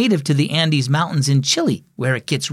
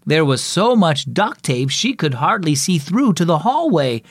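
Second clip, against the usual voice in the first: ordinary delivery, nothing marked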